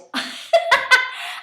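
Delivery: high-pitched burst of laughter; quick, high giggling laugh. Real, not acted. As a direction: cackle at your own slip. Laughs at own name slip.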